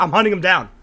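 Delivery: normal talking voice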